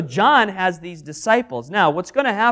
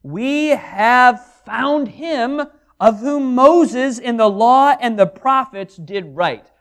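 high, loud, very slow proclamation; excited announcement; 5 semitones higher, 7 dB louder, slower than usual. Acted. Philip telling Nathanael, excited and loud. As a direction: declare it like the greatest news. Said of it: "saith unto him".

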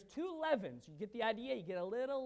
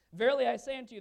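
proclaiming; 3 semitones higher, 12 dB louder, faster than usual. Retold or imitated. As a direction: declare it with authority. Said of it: "and saith".

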